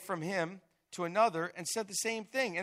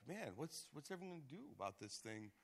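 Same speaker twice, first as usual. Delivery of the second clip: put-on young guy voice; 16 dB quieter than usual. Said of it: "Jacob standing up and saying".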